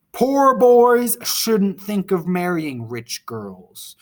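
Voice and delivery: slow, loud quoted warning; father's stern warning. Retold or imitated. Ginevra's father warning the young writer off, slow emphatic warning. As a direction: pronounce it slowly and forcefully, as a warning. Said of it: "Ginevra King's father warned".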